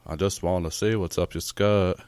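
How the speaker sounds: low, soft impression of an elderly man; Morgan Freeman. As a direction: drop into a hushed, deep celebrity voice. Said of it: "do the Morgan Freeman voice where he's like".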